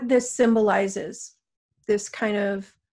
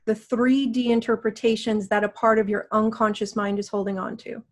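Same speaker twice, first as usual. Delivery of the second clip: guided meditation. Acted. Guides a healing session.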